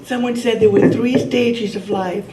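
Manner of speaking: ordinary talk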